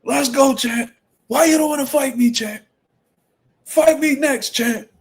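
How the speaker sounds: high, punchy challenge shouts; Shannon Briggs heckling. Acted. Streamer acting a boxer demanding the next fight, hyped heckling. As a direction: call out the champ, hammering each phrase. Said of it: "on my Shannon the Briggs shit".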